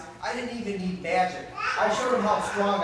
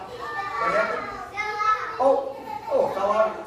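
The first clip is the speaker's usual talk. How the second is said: much higher, slow, dismayed; surprised; 4 dB louder than usual. Real, not acted. To the kids when the trick fails, a slow high surprise. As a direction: stretch it out high in mock dismay. Prop falls out mid-trick.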